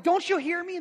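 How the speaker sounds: 2 loud, high exasperated yell; 3 shout it across the room; 1 yelling at the kids. Retold or imitated. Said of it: "But I'm yelling".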